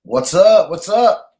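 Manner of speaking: calling out a big hello; loud greeting. Real, not acted. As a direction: shout a hearty what's up.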